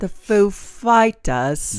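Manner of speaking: slow impression slipping into English; Christopher Walken. Co-host trying the band introduction, English-sounding Walken impression. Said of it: "we sound English".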